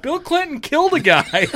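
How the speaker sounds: very high, mock-indignant comedian imitation; Norm Macdonald. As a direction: protest in a high voice, refusing to drop it. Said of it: "He goes".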